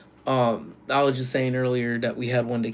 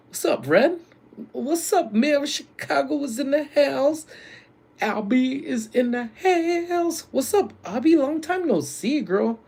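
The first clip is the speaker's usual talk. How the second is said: excited greeting; 14 semitones higher, 3 dB louder than usual. Real, not acted. Streamer welcomes friends joining the chat, excited greeting. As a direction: hail them in a high, playful holler.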